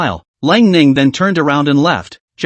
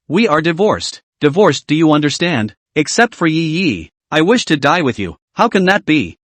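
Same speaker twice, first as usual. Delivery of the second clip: plain reading voice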